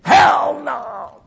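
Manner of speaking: high, loud yell; wake-up shout. Shown as a demonstration. Preacher repeating a pastor's translation to the congregation, shouting. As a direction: shout it to rouse dozing listeners. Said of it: "I just woke some of you up".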